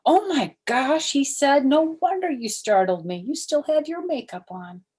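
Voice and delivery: a startled father blurting out; startled dad. Acted. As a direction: react loud and shaken. Daddy startled by clown face.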